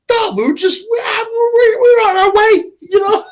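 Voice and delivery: high, crying, wailing stammer; panicked blubbering excuse; flustered whine. Retold or imitated. Mocking flustered Leon.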